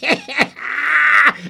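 high triumphant cackle; goblin cackle. Acted. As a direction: cackle as you leap away. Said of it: "cried the goblin".

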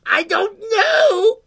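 very high, loud, stretched words; creature's cry. Acted. Creature answers girl mid-flight, high drawn-out cry. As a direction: gleefully sing out each word slowly. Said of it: "cried the creature".

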